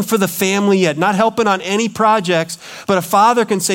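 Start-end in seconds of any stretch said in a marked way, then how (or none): none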